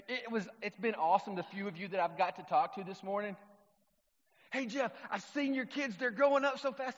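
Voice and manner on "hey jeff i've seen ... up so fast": high, bright-eyed mock gushing, louder at the end; gushing churchgoer. Pastor mimics a churchgoer fawning over his kids, high mock gush. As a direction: play the overeager friend at church.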